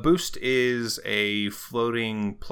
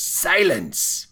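commanding shout; 10 semitones higher, 7 dB louder than usual. Acted. Game master as the villain silencing Rogar, commanding shout. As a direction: cut them off with a shouted command. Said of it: "And he shuts up".

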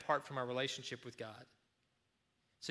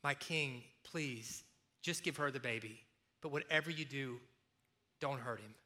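softer, pleading mother on her knees; begging mother; 3 semitones lower, 6 dB quieter than usual. Acted. Real mother begging the king for her baby, soft and pleading. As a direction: beg the king quietly for the child's life. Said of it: "weeping and begging".

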